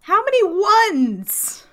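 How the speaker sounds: high, loud, stretched-out disbelief; incredulous shout. Real, not acted. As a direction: cry out in real disbelief at the dice. Reacting to a dice roll.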